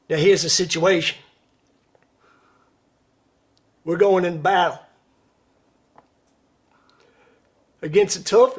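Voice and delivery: put-on loud, stirring officer's speech in a Deep South accent; rally the troops in a Deep South accent, pausing between lines. Officer addressing his men before Vietnam, loud Deep South accent. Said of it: "chose to do it in a, uh, Deep South accent".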